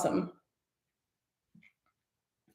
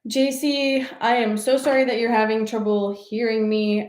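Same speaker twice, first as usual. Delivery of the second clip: normal talking voice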